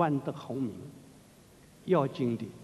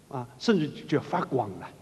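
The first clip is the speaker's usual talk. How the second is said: usual speaking voice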